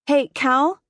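loud, high call to the couch; calling across the room. A narrator's reading. Mom calls to daughter on the couch, loud. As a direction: call out to get her daughter's attention. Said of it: "she called to the couch".

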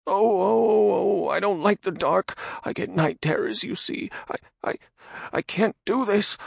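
high, loud fearful stammer; frightened babbling. Acted. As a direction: babble in terror. Radagon frightened of dark.

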